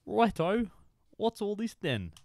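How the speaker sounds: lightly put-on copper voice, playful; odd put-on voice. Streamer trying out a silly voice on chat, lightly put on. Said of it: "I don't know what voice that was".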